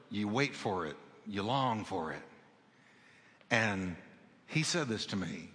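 hushed testimony. Acted. Preacher confiding his prayer to the church, softly hushed. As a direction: drop to a soft, low hush.

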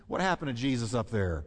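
normal talking voice

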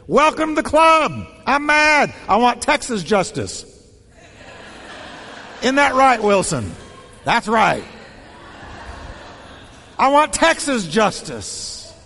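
joking angry rant; mock fury. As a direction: ham up wanting revenge.

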